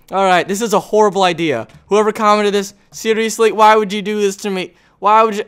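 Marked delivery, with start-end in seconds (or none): none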